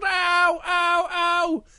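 high, acted ows; cub's pain cries. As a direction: cry out like a stuck little bear. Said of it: "He goes".

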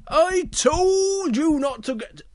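high, loud, drawn-out scolding; Big Bear voice. Acted. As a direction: scold with long stretched words. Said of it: "and Big Bear comes over".

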